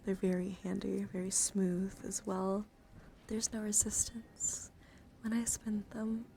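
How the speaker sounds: slow, hushed product showing; slow down and nearly whisper; soft ASMR demo. Acted. Streamer spinning health counters for chat, slow hushed voice.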